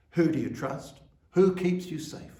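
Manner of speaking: ordinary talk, nothing marked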